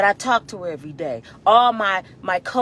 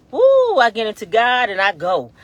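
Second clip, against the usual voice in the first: louder burst of excitement; carried away; 3 semitones higher, 10 dB louder than usual. Real, not acted. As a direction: let the spirit lift you. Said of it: "but I get so".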